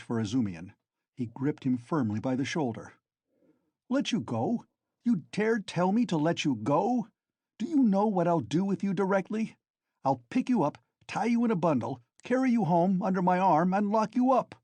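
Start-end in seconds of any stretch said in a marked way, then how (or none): 3.9-14.5 high, loud indignant threat; angry threat. A narrator's reading. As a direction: threaten to carry him off. Razumihin threatening, gripping shoulder.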